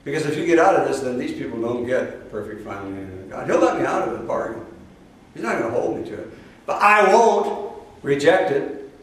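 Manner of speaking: usual speaking voice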